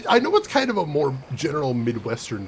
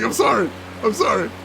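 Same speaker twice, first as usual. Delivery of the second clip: loud, higher-pitched Chicago-flavored apology; retold apology; 7 semitones higher, 6 dB louder than usual. Streamer apologizing to friends for slipping into accent, loud and high. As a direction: blurt out a mock-helpless apology. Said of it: "I'm like".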